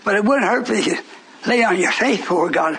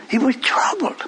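whisper; 3 semitones higher than usual. Acted. Dramatic sermon hush.

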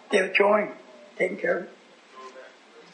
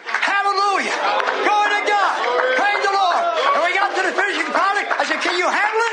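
high, driving revival preacher; full-force preaching; 8 semitones higher than usual. Real, not acted. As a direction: ride each phrase up high and ring it out.

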